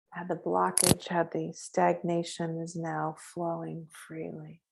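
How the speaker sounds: hushed healing visualization; guided meditation. Acted. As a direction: guide them gently into the light.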